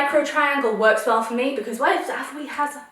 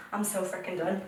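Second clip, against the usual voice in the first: much softer, lower, under the breath; quiet defeated mumble; deflated mutter; 4 semitones lower, 11 dB quieter than usual. Real, not acted. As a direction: let it drop away, muttered to yourself. Repeats line, then sinks low.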